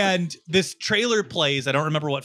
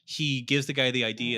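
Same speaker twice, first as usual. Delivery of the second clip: usual speaking voice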